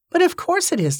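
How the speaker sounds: high-pitched, impatient retort; acted impatience, pitch jumping up. A narrator's reading. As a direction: answer as if it's blindingly obvious. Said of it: "said Cornelia impatiently".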